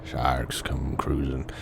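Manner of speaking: whisper. Acted. Grim story of shark attack.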